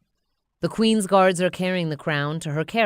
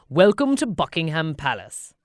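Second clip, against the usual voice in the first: royal advisor; 5 dB louder than usual. Acted. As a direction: greet the king with stately courtesy. Royal advisor welcomes Bob.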